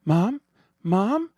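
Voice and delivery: frightened calling. Acted. Daughter calling her slumped mother in fright. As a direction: shake her awake with your voice.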